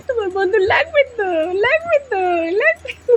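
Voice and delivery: squeaky teasing coo to the dog; squeaky baby talk. Acted. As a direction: coo at the pet in a tiny high voice. Singsong to pet.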